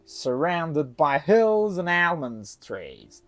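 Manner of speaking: usual speaking voice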